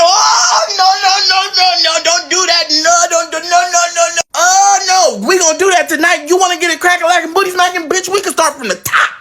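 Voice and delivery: high, frantic crowd voices; everybody jumping in. Retold or imitated. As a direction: pitch up like panicked relatives. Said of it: "Everybody's like".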